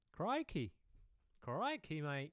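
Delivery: high Aussie exclamation with falling tune; hammy Australian amazement; mock Australian accent. Shown as a demonstration. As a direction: exclaim it like an astonished Australian presenter. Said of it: "doing the Australian accent".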